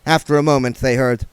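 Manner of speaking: plain reading voice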